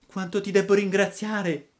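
high little puppet voice, thankful; grateful puppet. A narrator's reading. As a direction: thank your rescuer in a small high voice. (burattino riconoscente, voce acuta)

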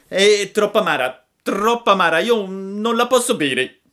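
very high, loud whining puppet; Pinocchio lamentoso. A narrator's reading. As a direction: whine loudly like a child refusing medicine. (burattino capriccioso e lamentoso)

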